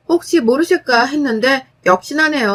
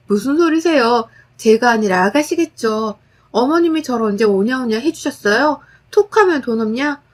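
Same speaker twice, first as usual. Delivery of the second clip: ordinary delivery, nothing marked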